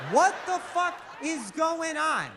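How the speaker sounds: high, laughing shout of disbelief; incredulous shout. Retold or imitated. Comic coming home to chaos, shouting in disbelief. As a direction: yell it in stunned disbelief. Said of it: "I'm like".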